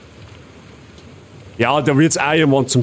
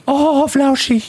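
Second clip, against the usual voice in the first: very high, slow cooing at a dog; praising a dog; 12 semitones higher, much slower than usual. Acted. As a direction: coo it up high and drawn out, like a puppy. Streamer coos at the game's dog. (hoher, langsamer Hundeknuddel-Ton)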